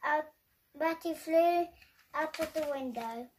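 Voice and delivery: ordinary delivery, nothing marked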